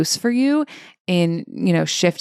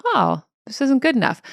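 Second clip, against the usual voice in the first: higher, light discovery; neutral noticing; 6 semitones higher than usual. Shown as a demonstration. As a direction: notice it with a mild, curious "oh". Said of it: "the second one in the different tone of voice".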